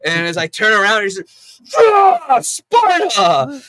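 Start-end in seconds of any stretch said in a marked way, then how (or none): 1.8-3.6 high, loud drawn-out roar; hammed-up war cry for a kick; mock battle cry.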